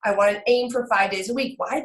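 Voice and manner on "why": normal talking voice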